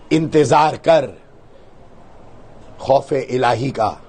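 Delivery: slow, low, measured warning with long pauses; solemn declamation. Retold or imitated. Speaker quotes his warning to the girl, solemn declamation.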